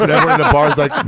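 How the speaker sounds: usual speaking voice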